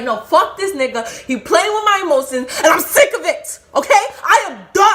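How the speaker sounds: high, loud re-enacted rant; furious, high-pitched acted-out outburst; screaming mad. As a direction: scream that you are fed up. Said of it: "I was screaming".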